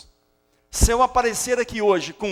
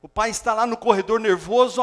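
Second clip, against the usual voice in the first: ordinary delivery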